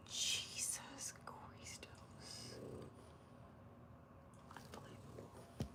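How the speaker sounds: breathy whispered disbelief. Real, not acted. Whispering to herself about the date. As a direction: whisper it under your breath, stunned.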